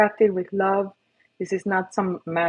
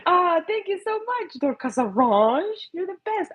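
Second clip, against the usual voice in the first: high pet praise; 10 semitones higher than usual. Real, not acted.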